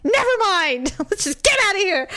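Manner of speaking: high-pitched huff with a laugh; mock exasperation. Wife hurrying husband out of the store, mock exasperation.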